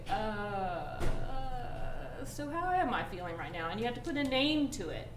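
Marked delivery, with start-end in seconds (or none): 0.1-3.7 slow, unsure self-check; hesitant searching. Shown as a demonstration. Counselor showing kids how to name a feeling, unsure. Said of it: "You have to say".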